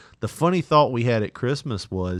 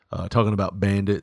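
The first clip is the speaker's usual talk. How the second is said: normal talking voice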